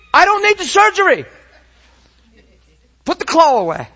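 high, loud re-enacted protest; insistent shout. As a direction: shout your refusal to the staff. Acted protest to the medical staff.

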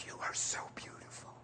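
tender, breathy whisper; hushed, loving murmur; sincere. Retold or imitated. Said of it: "I was so sincere".

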